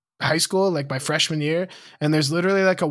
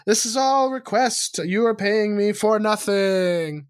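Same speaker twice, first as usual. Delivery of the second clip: slow, higher-pitched, hammed-up declaration; mock announcement; 3 semitones higher, slower than usual. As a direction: stretch out the words in playful mock declaration. Joins the bit, drawn out.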